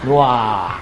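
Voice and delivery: ordinary delivery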